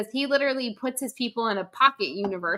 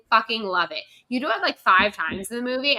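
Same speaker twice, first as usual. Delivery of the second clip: ordinary talk, nothing marked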